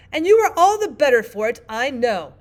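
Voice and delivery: loud, high triumphant Queen. A narrator's reading. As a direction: crow it in triumph. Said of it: "the Queen said triumphantly".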